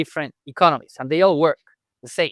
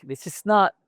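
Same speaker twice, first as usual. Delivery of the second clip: ordinary delivery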